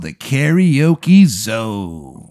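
slow, drawn-out venue announcement; mock-grand announcer voice; announcer flourish. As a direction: stretch the name like a hype announcer.

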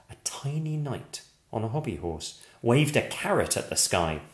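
ordinary delivery

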